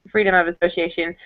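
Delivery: usual speaking voice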